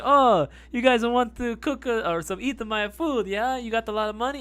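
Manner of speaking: mock Italian accent, eager and high; fake Italian accent. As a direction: play a cartoon Italian chef with a mock Italian accent. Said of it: "he had the fake Italian accent too".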